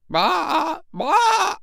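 high imitated bleating; sheep bleat. Old sheep bleating as she walks out of the wood. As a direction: baa like an old ewe. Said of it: "she cried".

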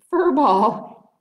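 usual speaking voice

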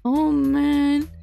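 usual speaking voice

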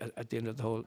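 ordinary talk, nothing marked

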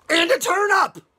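loud, high burst of excitement; excited duck. Acted. Storyteller voicing Duck pushing his turnip, loud and excited.

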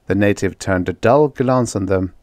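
ordinary delivery

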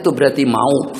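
ordinary delivery

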